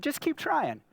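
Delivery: high, gentle push to keep going; high coaxing dad. Retold or imitated. Dad coaxing his six-year-old, high and encouraging. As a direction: coax her lightly upward. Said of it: "I said".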